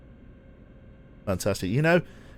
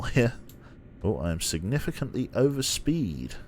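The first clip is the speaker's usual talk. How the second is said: usual speaking voice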